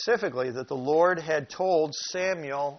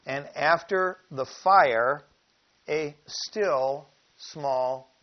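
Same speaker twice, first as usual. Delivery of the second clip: slow, louder solemn reading; scripture reading; 6 dB louder, slower than usual. Acted. Preacher reading the earthquake verse to his flock, slow and solemn. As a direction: draw out the verse, then hush on "still small". Reads Kings verse aloud.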